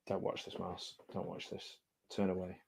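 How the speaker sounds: soft whisper to the pet. Real, not acted. Warning Mars away from the gory shark film, whispered. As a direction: hush the words so only Mars hears.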